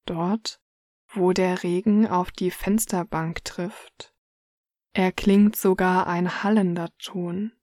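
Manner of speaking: normal talking voice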